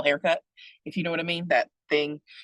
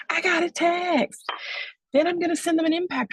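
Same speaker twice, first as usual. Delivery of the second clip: Love Island excitement. Retold or imitated. Speaker mimics a thrilled Love Island contestant getting a text, squealing high. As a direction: gush over a text like a reality star. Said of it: "see how excited they are".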